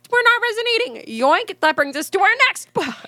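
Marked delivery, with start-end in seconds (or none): 0.1-1.0 much higher mock lament; a hint of the same hammed-up voice; high mock voice again. Shown as a demonstration. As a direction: repeat the prissy high line. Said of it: "Speaking of".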